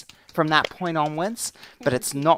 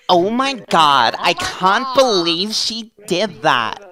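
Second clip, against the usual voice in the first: loud, high, exaggerated Valley Girl; over-exaggerate the feminine intonation, drawn out; hammed-up Valley Girl outrage; 4 semitones higher, 9 dB louder than usual. Shown as a demonstration. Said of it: "the Valley Girl impression".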